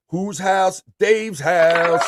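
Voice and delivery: high, slow hype chant; call and response. Acted. Host leading a chat chant, high and drawn out. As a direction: chant it to the crowd.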